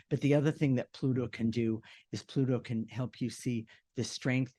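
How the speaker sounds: ordinary talk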